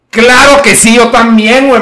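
loud, high sarcastic yes; loud ironic enthusiasm; mock eager. Real, not acted. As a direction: agree way too eagerly.

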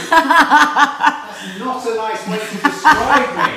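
high laughing outrage; mock offended laugh. Real, not acted. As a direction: pretend to be scandalised, laughing. Mock offence at wife's remark.

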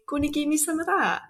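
iron out the Gonna give me some of that to sound polite; overly polite. Shown as a demonstration. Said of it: "very polite when you say".

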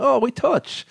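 high-pitched mock kickabout shout; retold excited call. Retelling a football kickabout.

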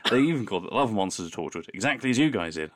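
usual speaking voice

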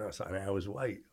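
hushed, unvoiced retelling; whispered taxi reply; quiet aside. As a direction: drop to a near whisper. Retold taxi call, whispered.

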